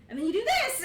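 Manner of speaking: high, loud demo cry; high, loud fight-lesson shout; punchy yell. Acted. Coaching punch, yells.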